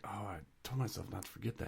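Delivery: quick, soft self-reproach; rushed quiet grumble; mock self-scolding. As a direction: mutter it fast under your breath. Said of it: "when you were like".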